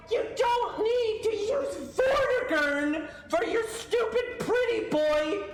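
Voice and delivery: high-pitched, drawn-out indignation; incredulous, stretching the words out high; exasperated rant. Real, not acted. Gamer rants about Vortigern being wasted on a moth monster, exasperated.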